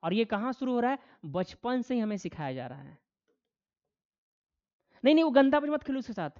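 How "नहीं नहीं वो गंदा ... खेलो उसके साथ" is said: quick, higher, louder mock scolding; imitated parental scolding. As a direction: imitate a parent warning a child off, fast and raised. Voicing a parent forbidding a playmate. (डाँटते माता-पिता की नकल, तेज़ और ऊँची आवाज़)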